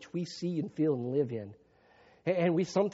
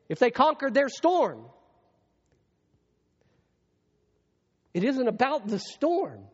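higher and louder, driving each point home; full-force preaching; 8 semitones higher, 5 dB louder than usual. Acted. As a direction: preach it hard to the congregation.

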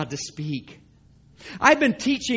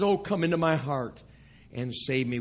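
soft plea; 7 semitones lower, 6 dB quieter than usual. Acted. Lost person pleads for salvation.

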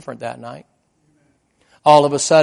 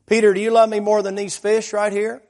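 higher, louder questioning of Peter; voicing the Lord's probing question; acted pointed question; 8 semitones higher, 5 dB louder than usual. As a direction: put it to him as a challenge, pitched up. Voices the Lord questioning Peter.

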